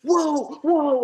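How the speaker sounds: high, loud startled cry; mock panic. Gunman retold as his gun goes off by accident, mock panic.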